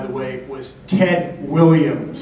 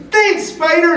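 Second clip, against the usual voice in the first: put-on high, loud little-kid thanks; Zach; 14 semitones higher, 5 dB louder than usual. Little kid thanking player for autograph, high loud kid impression. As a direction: chirp it as an imitated small fan. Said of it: "And the kid goes".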